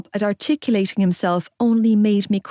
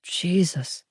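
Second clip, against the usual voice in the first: father muttering in shock; muttered; 2 semitones lower, 5 dB quieter than usual. A narrator's reading. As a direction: mutter it under your breath. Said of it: "my father muttered".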